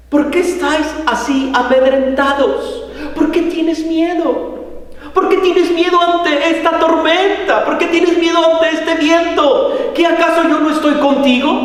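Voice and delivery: high-pitched, insistent questioning; urgent reproach. Retold or imitated. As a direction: challenge their fear, pressing each question. Acted reproach of frightened disciples.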